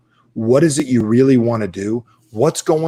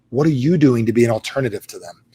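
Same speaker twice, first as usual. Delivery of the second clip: normal talking voice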